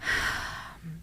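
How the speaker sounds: long tired exhale; weary sigh. Retold or imitated. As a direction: sigh out the bad day. Acting husband's tired sigh.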